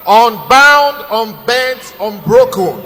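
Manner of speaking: loud announcer call of the bank slogan; slogan shout. Acted. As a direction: bellow it like a stadium ad.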